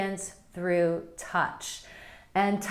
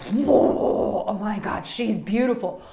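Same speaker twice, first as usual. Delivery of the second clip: excited inner gasp; 5 dB louder, slower than usual. Retold or imitated. Men's hidden inner reaction.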